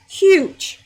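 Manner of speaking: excited. Real, not acted. Reacting to Hajj cancellation news.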